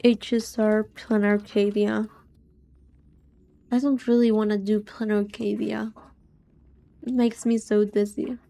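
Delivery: normal talking voice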